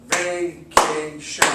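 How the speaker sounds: "Vacation" very slow in three beats, "Va-ca-tion"; slow syllable split. Shown as a demonstration. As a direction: clap "Va… ca… tion". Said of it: "clapping syllables demo".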